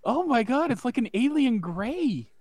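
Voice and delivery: shocked. Real, not acted. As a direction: gasp it out in disbelief. Shocked at the face.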